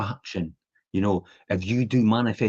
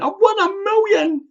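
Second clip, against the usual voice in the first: high, loud, drawn-out victory cry; hammed-up elation; jubilant; 5 dB louder than usual. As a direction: squeal it like a lottery winner. Acted fake lottery win.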